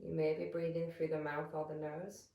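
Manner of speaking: calm, measured meditation voice with long pauses; guided meditation. Real, not acted. Yoga teacher settling listeners into breath awareness, calm guided meditation. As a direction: guide them gently into stillness, leaving space between phrases.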